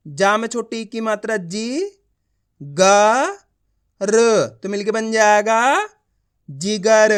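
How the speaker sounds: slow teaching drill, each sound of जिगर held apart before joining; letter-by-letter reading. Shown as a demonstration. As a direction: spell out जी, ग, र with pauses, then blend into a firm जिगर. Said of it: "एक एक अक्षर का उच्चारण करके".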